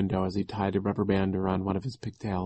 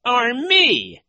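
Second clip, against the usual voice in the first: very high, loud interjection; put-on high intruder's voice; voice from the doorway; 8 dB louder than usual. A narrator's reading. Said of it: "came a voice from the doorway".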